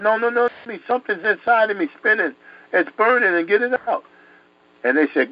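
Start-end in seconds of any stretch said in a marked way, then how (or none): 0.0-4.0 high, pleading mock panic; retold panicked yelling. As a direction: act out a boy desperate to be believed. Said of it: "I was screaming and yelling".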